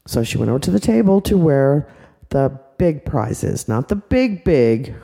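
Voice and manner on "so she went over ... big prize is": plain reading voice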